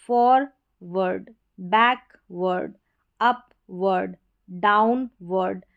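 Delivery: फॉरवर्ड slowly split as "for, vurd"; slow word list, each ending in a separate "vurd" as in अपवर्ड; syllable-by-syllable demo. Shown as a demonstration. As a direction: break बैकवर्ड and डाउनवर्ड before "vurd". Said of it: "इस तरीके से pronounce करेंगे".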